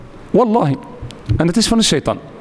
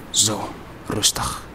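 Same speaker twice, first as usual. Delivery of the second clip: soft, slow whispered calm; 11 semitones lower, 9 dB quieter, slower than usual. Shown as a demonstration. Preacher showing calm prayer in a slow whisper. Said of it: "Wees rustig in jouw gebed".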